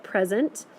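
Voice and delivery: "present" said PREZ-ent; stress on first syllable. Shown as a demonstration. Teacher settling how we should say it, PREZ-ent. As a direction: noun form "present", PREZ-unt with weak ending. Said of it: "is how we should say it".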